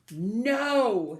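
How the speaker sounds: loud, drawn-out refusal; protesting. A narrator's reading. Sophie refusing as her sister grabs Gorilla, protesting loudly. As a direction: belt out a long, cross refusal.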